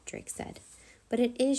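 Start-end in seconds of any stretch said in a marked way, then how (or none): 1.1-1.6 upset cry. A narrator's reading. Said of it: "she cried".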